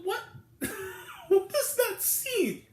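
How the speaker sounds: high, laughing incredulous question, drawn out slowly; retelling his own laughing reaction in a high voice; laughing disbelief. Retold or imitated. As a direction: laugh through the words at how absurd the scene is. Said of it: "It almost made me laugh".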